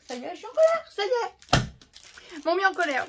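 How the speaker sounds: high, loud, half-joking angry whine; mock anger. Real, not acted. To viewers after finding stolen stamps, comic anger. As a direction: play up being cross.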